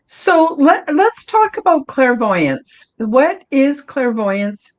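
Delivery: announcing the topic. Real, not acted. Host announcing new topic.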